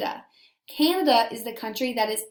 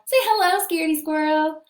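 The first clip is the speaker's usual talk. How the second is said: bright, higher coaxing call to the puppet; high, louder friendly prompt; cheerful prompt; 5 semitones higher, 6 dB louder, faster than usual. Acted. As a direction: coax the shy squirrel to greet.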